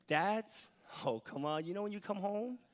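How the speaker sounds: usual speaking voice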